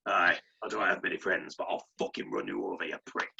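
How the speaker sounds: low, gravelly tough-bastard threat; Sean Dyche impression. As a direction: growl it like a cigar-smoking hard man. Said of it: "that one really gravelly, tough bastard".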